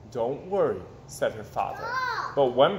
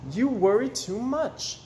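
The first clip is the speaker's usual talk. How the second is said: mother's gentle chiding; 7 semitones higher, slower than usual. Acted. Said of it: "said her mother".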